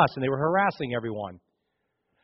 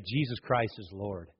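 soft, lower firm Jesus Christ is Lord; sincere confession; 6 semitones lower, 9 dB quieter than usual. Shown as a demonstration. Preacher showing the believer's confession, soft and sincere. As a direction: say it quietly with conviction. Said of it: "others say".

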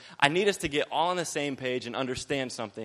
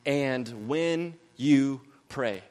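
slow emphatic reading; much slower than usual. Shown as a demonstration. Preacher reading the verse slowly to the congregation. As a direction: read each word slowly and deliberately. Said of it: "Jesus is trying to get some point across".

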